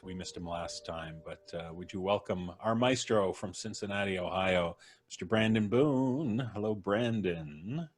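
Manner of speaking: ordinary talk, nothing marked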